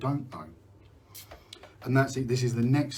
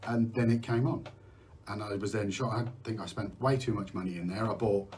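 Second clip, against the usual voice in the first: normal talking voice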